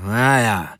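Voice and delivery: drawn-out grudging grumble; frosty grumble. A narrator's reading. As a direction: grumble a reluctant concession. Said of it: "brummte er frostig und".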